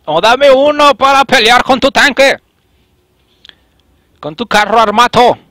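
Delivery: high, loud, slow fake Italian accent yelling; mock Italian rant. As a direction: taunt the tank driver in a hammed-up Italian accent. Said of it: "Mi acento italiano es diferente". (acento italiano exagerado y burlón)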